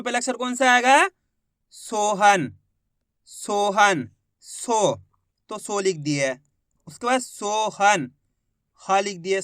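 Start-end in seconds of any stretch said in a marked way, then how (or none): none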